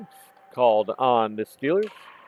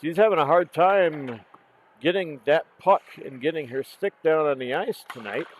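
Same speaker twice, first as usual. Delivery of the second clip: ordinary talk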